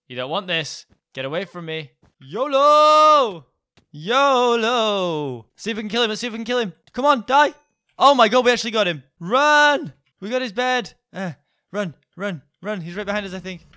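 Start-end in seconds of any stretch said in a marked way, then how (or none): 2.2-5.5 loud drawn-out battle cry; yolo shout. Real, not acted. Shouting yolo while attacking. 5.6-13.3 frantic excited yelling; high, loud, urgent gamer panic; being chased. Real, not acted. Fight against chaser, excited yelling.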